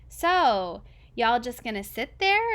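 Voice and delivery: loud, impatient call; bossy call. Acted. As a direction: demand it from the doorway. Junior bossing them from doorway.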